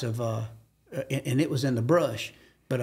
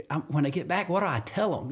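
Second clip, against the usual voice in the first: ordinary delivery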